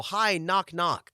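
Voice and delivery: high, meek entrance; self-lowering greeting. Acted. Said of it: "I lower myself".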